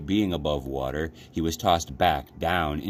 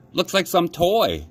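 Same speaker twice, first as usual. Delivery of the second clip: put-on higher, louder old fisherman's voice; grizzled old man; 12 semitones higher, 5 dB louder than usual. A narrator's reading. Old fisherman picking up the rabbit, musing aloud. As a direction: muse over the odd catch. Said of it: "said the grizzled old man".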